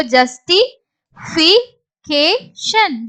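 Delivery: slow, separated syllables. Shown as a demonstration. Teacher builds the word for learners, syllable by syllable. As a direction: break "justification" into pieces with pauses.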